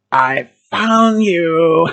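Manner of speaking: slow, drawn-out creepy threat; singsong menace, long-held vowels; taunting clown. Acted. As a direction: stretch each word like a hunting maniac. Clown spots the hiding narrator.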